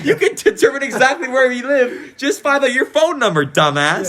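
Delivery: high-pitched quote half laughing it out; mock outrage breaking into laughter; laughing disbelief. Griping to friends about leaked numbers, laughing incredulously. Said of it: "I'm, like, thinking".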